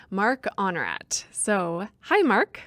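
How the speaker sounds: normal talking voice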